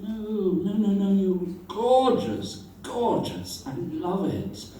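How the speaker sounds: high, gushing reassurance; a hint of a doting friend; lightly voiced kind friend.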